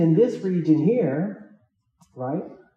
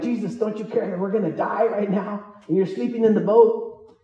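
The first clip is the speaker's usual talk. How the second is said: mock panic; 5 semitones higher than usual. Disciples waking Jesus in the storm, mock panic. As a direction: play the terrified disciples, hammed up.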